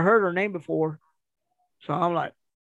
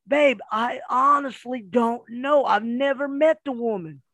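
re-enacted pleading denial; 6 semitones higher than usual. Husband denies knowing the woman to his wife, acted protest. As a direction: plead innocence, lightly acted.

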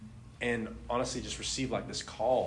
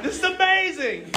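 high, loud amazed exclaim; amazed excitement; 9 dB louder than usual. Retold or imitated. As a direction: marvel out loud in delight. Retelling the man's healing.

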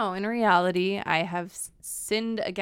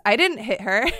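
loud, higher-pitched son's denial; defensive child; 4 semitones higher, 9 dB louder than usual. Retold or imitated. Mom imitates her son when she walks in, loud defensive protest. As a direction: blurt it out like a kid caught out. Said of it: "my daughter's screaming and Edwin goes".

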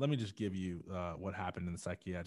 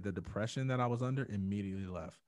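normal talking voice

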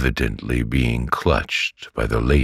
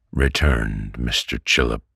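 ordinary delivery

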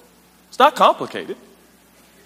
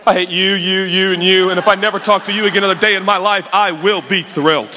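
fast, lowered run of mock hatred; rapid comic venting, pitched down; exhaled venting; 3 semitones lower, faster than usual. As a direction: let it all pour out in one breath. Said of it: "I gotta exhale".

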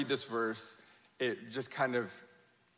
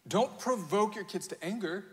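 normal talking voice

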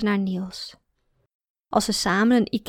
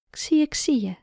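gentle whisper; 5 semitones higher than usual. A narrator's reading. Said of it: "comforting child in Chinese words".

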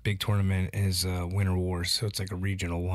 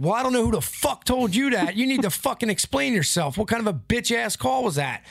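high, heated retold rant at the ref; arguing the call with the referee, retold fury; retold angry rant; 11 semitones higher than usual. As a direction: replay losing it at the ref. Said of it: "And I said".

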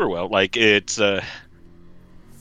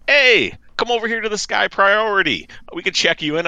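higher, friendly staff voice; lightly voiced airline agent; cheerful agent voice; 9 semitones higher than usual. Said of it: "they're like".

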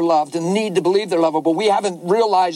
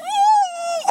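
put-on girl's wail.

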